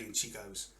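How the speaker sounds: ordinary talk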